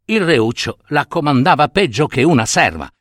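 ordinary delivery, nothing marked

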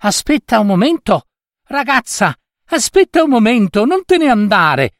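a small high fairy woman calling from the woods; little voice. Acted. Little woman calling the girl back, in a tiny high voice. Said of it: "una vocina provenire dal bosco".

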